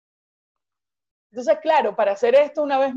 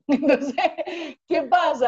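laughing; 3 semitones higher than usual. Real, not acted. Laughing at her own story.

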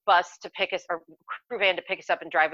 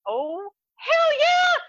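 thrilled; 4 dB louder, much slower than usual. Retold or imitated. Thrilled at invitation to set.

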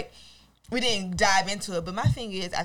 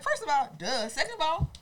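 incredulous comeback; 10 semitones higher than usual. Retold or imitated. Co-host answering a nosy commenter, incredulous and high. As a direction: pitch climbing to a squeal. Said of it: "And I was just like".

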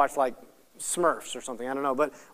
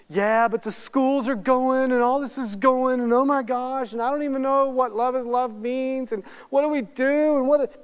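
high, run-on imitation of a worrier; mock fretting; 6 semitones higher than usual. Churchgoer frets about the schools and the world, mock fretting. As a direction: pile up worries in a put-on fretful voice. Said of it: "Stop it".

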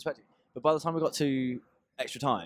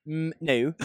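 higher, lightly mock West Country accent; 5 semitones higher, 3 dB louder than usual. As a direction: imitate yourself slipping into West Country. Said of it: "speaking a West Country accent all week".

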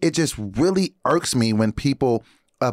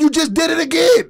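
high, louder exasperated call-out; retold exasperated call-out; 13 semitones higher, 5 dB louder than usual. Host retells catching the guard rolling his eyes, high and exasperated. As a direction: snap back at the guard who rolled his eyes. Retold confrontation, pitch jumps.

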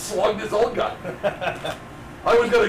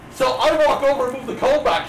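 ordinary delivery

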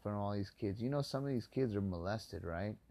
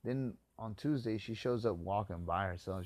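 usual speaking voice

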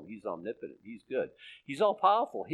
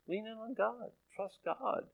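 soft, higher, gentle urging; tender reassurance; 9 semitones higher, 9 dB quieter than usual. Real, not acted. Preacher to people with lives in mayhem, tender reassurance. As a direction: quietly comfort someone in trouble. Consoling listeners in trouble.

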